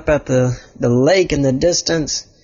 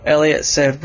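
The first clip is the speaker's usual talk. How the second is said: ordinary delivery, nothing marked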